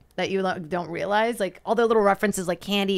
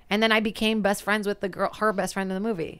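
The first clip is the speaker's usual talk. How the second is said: usual speaking voice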